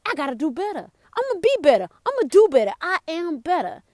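lightly acted resolve, pitch jumping up; self-motivating declaration; determined self-talk. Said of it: "You could be like".

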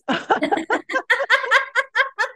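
high delighted laugh; burst of laughter. Real, not acted. Laughing as the memory of her ex-best friend clicks.

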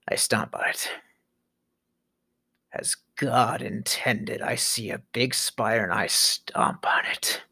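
low, hushed threat; menacing whisper. Acted. Comic confesses to stomping on spiders, menacing whisper. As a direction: whisper it with grim relish.

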